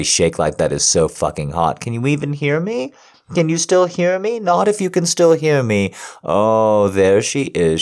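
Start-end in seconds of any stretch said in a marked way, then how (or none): 1.8-5.9 excited. Acted. Calling to partner overwhelmed.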